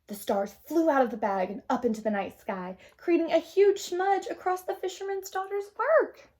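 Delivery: put-on gentle read-aloud to children; storybook narration. A narrator's reading. Teacher reading a picture book to the class, gentle narration.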